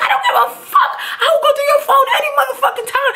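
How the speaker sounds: very high, fast shouted comeback; defiant yelling. Retold or imitated. Girlfriend yelling back at boyfriend about his phone, defiant. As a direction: reenact screaming back in a blazing row.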